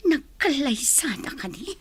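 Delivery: rising, stunned disbelief. Acted. Wife seeing husband's wedding photo, disbelieving. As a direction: refuse to believe it.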